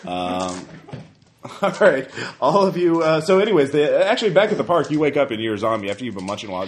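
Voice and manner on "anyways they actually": normal talking voice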